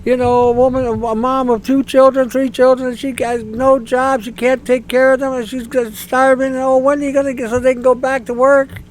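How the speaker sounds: high, pleading sob-story parody; mock emotional plea. Parodying politicians playing on emotions, high pleading whine. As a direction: lay the sympathy on thick, high. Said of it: "They're playing on the emotions".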